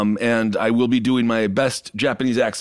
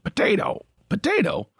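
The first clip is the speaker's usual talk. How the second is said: first whispered, then jumping high; muttered then yelped; 6 semitones higher, 4 dB quieter than usual. Retold or imitated. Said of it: "the homeless man said".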